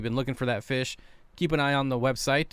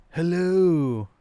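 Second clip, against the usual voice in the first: a slightly higher, louder put-on hello; Dan's Fish impersonation; 5 semitones higher, 5 dB louder than usual. Streamer trying his Dan impression again. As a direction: another go at the imitation. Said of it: "That's my Dan's Fish impersonation".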